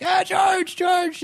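high yelled calls of a name; mock crowd yelling. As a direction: call out like a starstruck fan. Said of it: "people were, like, yelling".

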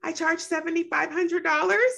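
high and unsure, the price lifted into a question; rising inflection. Retold or imitated. Speaker recalls quoting her fee, nervous rising pitch. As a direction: let the price rise like you are asking permission. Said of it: "I heard the inflection of my voice say".

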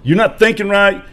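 loud, high-pitched burst of anger; fed-up yell at a friend who's quitting; retold angry outburst. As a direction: explode at your friend. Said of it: "I'm hitting my steering wheel".

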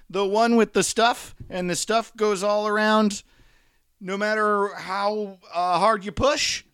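high, loud, questioning babble; flustered rambling. Real, not acted. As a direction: grope for words, pitch stuck up high. Rambling incoherently, losing the thread.

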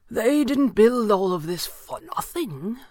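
slow, high, unhurried character voice; stranger's voice. A narrator's reading. The stranger answers, figure voice.

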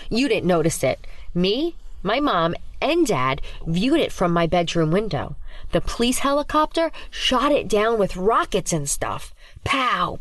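put-on high, breathless kid storyteller; rising excitement, act out the battle; excited girl. Ava excitedly describes alien shootdown.

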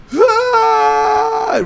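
long very high mock scream; held-out scream. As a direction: hold the scream out. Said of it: "with that held out scream".

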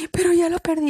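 high, loud tearful wail; crying wail. Retold or imitated. Retelling herself crying at station. (lamento lloroso, agudo y fuerte)

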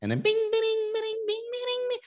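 high imitated plinking; ukulele plinks. Shown as a demonstration. Imitating TV show ukulele music. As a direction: sing the ukulele jingle. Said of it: "ukulele in the background".

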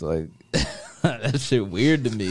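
slow, through a laugh. Real, not acted. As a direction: laugh it out in disbelief. Laughs while telling.

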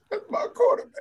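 very high, squealing fan; mock fan voice. Teasing imitation of friend.

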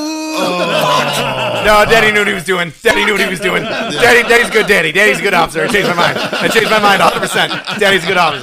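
high, loud, fast-gushing teasing; loud mock-adoring praise of Daddy. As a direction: gush over Daddy in fake awe. Mocking the son's changed view.

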